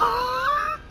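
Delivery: squeaky laugh burst; high burst of laughter. Real, not acted. Laugh after fight.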